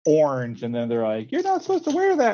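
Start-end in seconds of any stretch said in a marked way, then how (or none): 1.3-2.3 very high mock scolding voice; squeaky kid scolding the teacher; high, prissy kid voice; mocking students.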